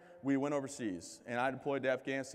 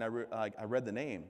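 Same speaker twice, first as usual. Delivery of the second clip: ordinary delivery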